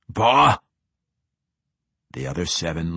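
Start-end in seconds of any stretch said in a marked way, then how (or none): 0.1-0.6 dwarf snort. A narrator's reading. Dwarf retort.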